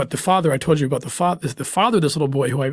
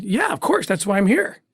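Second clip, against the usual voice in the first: much higher, breezy and easygoing; casual feigned eagerness; 6 semitones higher than usual. Retold or imitated. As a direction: play along like it's nothing. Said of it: "I said".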